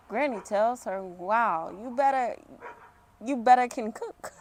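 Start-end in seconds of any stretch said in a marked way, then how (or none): 1.0-2.4 put-on Jamaican accent. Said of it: "I need a Jamaican accent for this". 3.2-4.3 put-on first try at a Jamaican accent. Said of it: "I need a Jamaican accent for this".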